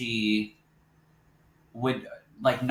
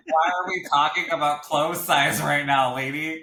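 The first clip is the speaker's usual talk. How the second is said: offended; 5 semitones higher, 5 dB louder than usual. Retold or imitated. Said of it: "I was so offended".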